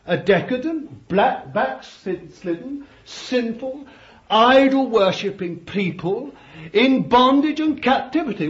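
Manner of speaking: high, loud, hammering each word; preaching at full force. Acted. Preacher denouncing a backslidden nation, high and loud. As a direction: pile up the sins from the pulpit. Preacher lists Israel's sins.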